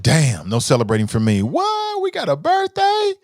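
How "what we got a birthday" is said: high, sudden squeak of surprise; playful high-pitched surprise; surprised. Real, not acted. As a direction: jump up in pitch, caught off guard. Discovers it is someone's birthday.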